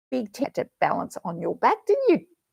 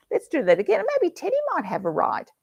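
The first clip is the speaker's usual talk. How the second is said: usual speaking voice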